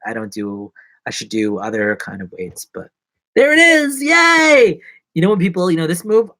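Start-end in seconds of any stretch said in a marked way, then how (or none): 3.4-4.8 high, loud celebratory whoop; pitched-up triumphant shout; excited cheer. Real, not acted. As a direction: cheer it out gleefully. Cheering as he finds something.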